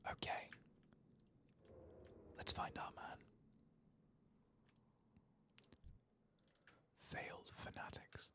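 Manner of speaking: hushed whisper while sneaking; stealthy whisper creeping through a house. Real, not acted. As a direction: keep it breathless so the enemies don't hear. Sneaking up on enemies.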